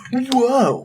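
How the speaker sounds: high, breathless gasp of wonder; stunned by the view; awed whoa. Retold or imitated. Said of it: "you were just like".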